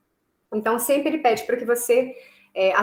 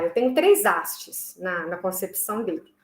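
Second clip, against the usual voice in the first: ordinary talk, nothing marked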